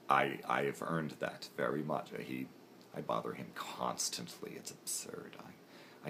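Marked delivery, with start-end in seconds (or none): 4.6-6.1 whisper. Real, not acted. Hushed aside about bothering Duel.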